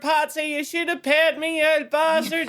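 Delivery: mock insulting giant. Scottish giant shouting back at Finn McCoo, mock rage. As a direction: hurl abuse across the sea, laughing.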